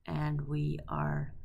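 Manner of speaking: normal talking voice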